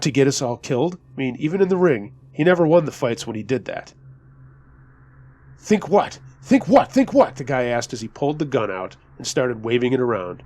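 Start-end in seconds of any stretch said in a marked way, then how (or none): 5.6-7.3 high, rapid-fire repeated taunt; agitated challenge. A narrator's reading. Gunman pulling his weapon on the bouncer, agitated challenge. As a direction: fire it back higher and louder each time.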